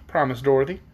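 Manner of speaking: plain reading voice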